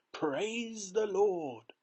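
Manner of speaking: high, very slow exultant cry; jubilant praise. A narrator's reading. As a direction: stretch it out in thanks. Grandpa Joe rejoicing.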